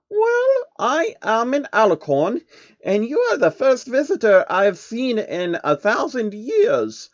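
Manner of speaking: high, wondering princess voice; a pony princess amazed by a stranger; awed princess. Acted. Luna voiced.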